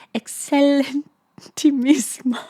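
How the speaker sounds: high, drawn-out Italian exclamation; attempted Italian accent. A narrator's reading. Lisa quoting his praise, clumsy Italian accent. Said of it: "probably should have rehearsed my accent".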